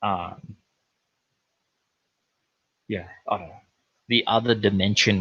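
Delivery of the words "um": ordinary talk, nothing marked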